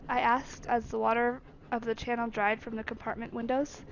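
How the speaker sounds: usual speaking voice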